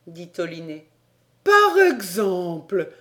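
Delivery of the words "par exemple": loud, drawn-out outcry; indignant exclamation. A narrator's reading. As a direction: stretch it out in outraged astonishment. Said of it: "s'écria Paganel". (exclamation indignée et appuyée)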